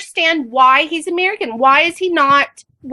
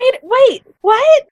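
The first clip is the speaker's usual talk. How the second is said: higher, startled double take; taken aback; 10 semitones higher, 4 dB louder, much slower than usual. Retold or imitated. Recalling own reaction to the American claim, taken aback. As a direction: react in baffled disbelief, pitched up.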